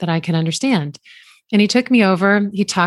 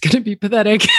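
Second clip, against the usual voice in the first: laughing; 5 semitones higher, faster than usual. Real, not acted. Laughing at her bad French.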